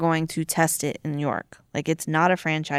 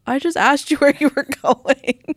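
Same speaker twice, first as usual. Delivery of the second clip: defensive protest; 8 semitones higher, 5 dB louder than usual. Retold or imitated. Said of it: "And I'm like".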